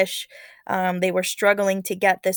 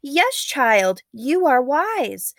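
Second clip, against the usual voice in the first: higher, slow, lightly voiced elder; wise old woman; 7 semitones higher, 5 dB louder, much slower than usual. Old woman praising the girl, lightly voiced slow elder. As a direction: grant the girl's wish slowly, like a wise elder.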